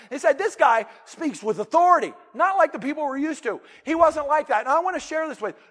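full-force preaching. Acted. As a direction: preach it out with fervor to the crowd.

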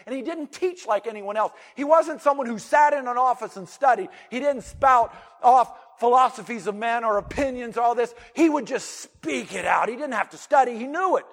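full-force preaching. Acted. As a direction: preach it out with fervor to the crowd.

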